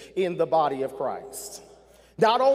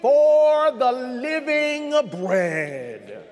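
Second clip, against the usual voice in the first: preaching at full force; 4 semitones higher than usual. Acted. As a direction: build the sermon to its peak. Preaching build to climax.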